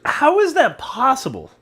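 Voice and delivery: high, drawn-out disbelief; slow, high-pitched astonishment; incredulous. Real, not acted. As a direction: stretch it out, pitch jumping in amazement. Stunned by the correct answer.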